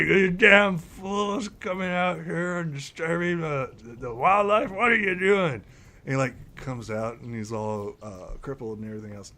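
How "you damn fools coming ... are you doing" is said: put-on high, cranky old elf scolding; angry scolding. Elder elf scolding the fools disturbing wildlife. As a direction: scold them as a grumpy elder.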